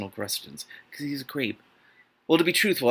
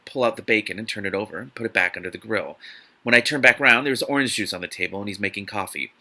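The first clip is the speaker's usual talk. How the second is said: usual speaking voice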